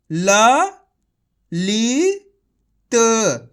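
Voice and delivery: "ल", "ली", "त" as separate held sounds with pauses; direct matra reading. Shown as a demonstration. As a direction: sound out "ल", "ली", "त" one by one, stretched. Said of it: "direct मात्रा का उच्चारण करके पढ़ेंगे".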